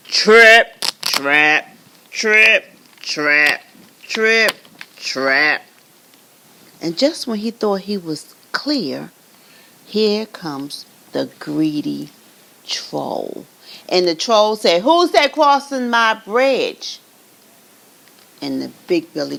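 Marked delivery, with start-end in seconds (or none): 0.1-5.6 loud, heavy trip trap; heavy stomping. A narrator's reading. Narrator stomping the biggest goat across the bridge. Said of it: "the biggest billy goat". 14.8-17.0 loud, slow troll challenge. Acted. Troll challenging the biggest goat, loud and slow.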